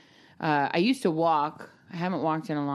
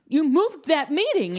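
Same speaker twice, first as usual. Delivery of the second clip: mock rage; 10 semitones higher, 4 dB louder than usual.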